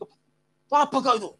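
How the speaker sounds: high-pitched scornful challenge; acted Medan-style scolding; angry Medan father. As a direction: throw it at the son in contempt. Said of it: "gaya Medannya".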